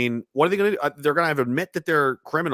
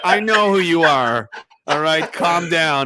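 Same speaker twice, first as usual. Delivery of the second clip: slow, louder, brushing her off; mock dismissive; 4 semitones higher, 6 dB louder, slower than usual. To the reporter on the phone, slow dismissive brush-off. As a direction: talk her down slowly like she's overexcited. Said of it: "I was like".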